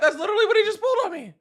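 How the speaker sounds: high-pitched, fast, through laughter; laughing. Real, not acted. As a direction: say it while cracking up. Repeats line while laughing.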